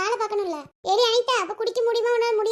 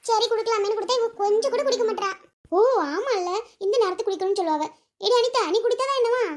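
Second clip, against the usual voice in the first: usual speaking voice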